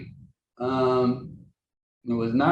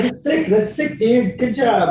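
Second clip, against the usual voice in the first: high, louder mock compliment; insincere praise; 9 semitones higher, 4 dB louder than usual. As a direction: praise it unconvincingly, pitched up. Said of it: "That sounded insincere".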